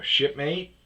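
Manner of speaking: teasing call. Retold or imitated. Needling an old Navy vet.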